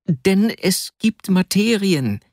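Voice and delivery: high, slow, emphatic protest; protesting Vasja. A narrator's reading. Pinned friend explaining to Arkadi, high slow protest.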